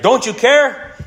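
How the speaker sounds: high, loud panicked cry; panicked plea. Retold or imitated. Disciples wake sleeping Jesus in the storm, panicked high cry. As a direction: plead as if the boat is sinking.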